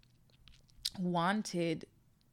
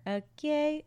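high, bright excited okay; hyped okay; 10 semitones higher than usual. Acted. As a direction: pump yourself up on Okay. Okay twice, hyped then quiet.

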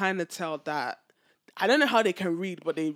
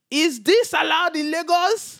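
slow, high and louder mock officer; imitated Lagos policeman, slow and raised; stern policeman; 9 semitones higher, 7 dB louder, slower than usual. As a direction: play the officer questioning her, drawn out and pointed. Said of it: "He said".

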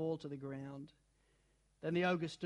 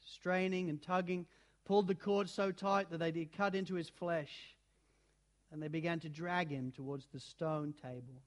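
ordinary delivery

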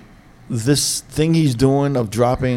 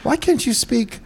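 high-pitched mock mom nagging, not really yelling; scolding mom impression; 8 semitones higher than usual. Son mimicking his mom's complaints about cursing on the podcast, mock scolding. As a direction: imitate your mom telling you off, voice pitched way up. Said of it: "my mom yells at me".

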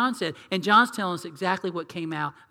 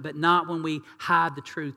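ordinary delivery

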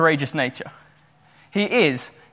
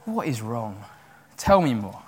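softer, lower enquiry; quiet, concerned retold question; gentle concern; 4 semitones lower, 5 dB quieter than usual. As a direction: gently draw someone out, voice dropped. David gently seeking out the need.

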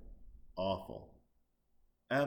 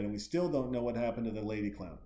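usual speaking voice

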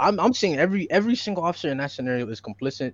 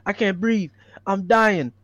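quoting pleas; 5 semitones higher than usual. Retold or imitated. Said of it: "He said".

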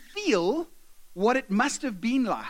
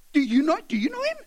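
quick, high, louder challenge; acted agitated grumbling; indignant questioning; 6 semitones higher, 5 dB louder, faster than usual. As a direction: demand an answer from the next person. Slaves grumble to each other.

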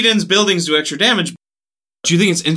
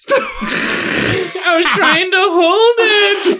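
laughing; 13 semitones higher, much slower than usual. Real, not acted. Laughing after holding in sneeze.

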